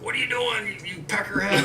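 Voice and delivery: put-on high, laughing tease from an old buddy; old coworker teasing. Old coworker catches him smoking, mocking high laugh. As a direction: needle him like a cranky old trucker, laughing. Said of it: "He's like".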